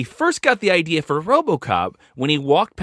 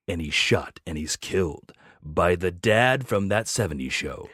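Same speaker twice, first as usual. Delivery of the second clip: movie trailer voice; 4 semitones lower, 3 dB quieter than usual. Pretend. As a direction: announce it like a film trailer. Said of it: "I'm gonna give this to you in the movie voice".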